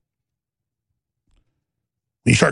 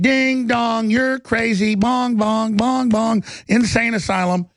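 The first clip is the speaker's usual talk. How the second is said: mocking sing-song; 6 semitones higher, slower than usual. Real, not acted. Mocking someone as crazy.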